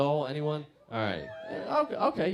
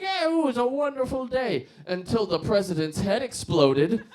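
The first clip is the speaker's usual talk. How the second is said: put-on old drunk storyteller; 9 semitones higher, 8 dB louder than usual. Old man tells the punchline.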